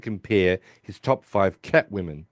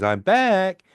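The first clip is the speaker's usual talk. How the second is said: high-pitched sing-out, imitating; hammed-up cheery arrival call; mock triumphant return; 10 semitones higher, 4 dB louder than usual. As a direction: announce yourself with playful glee. Imitates drugged Sheldon announcing his return.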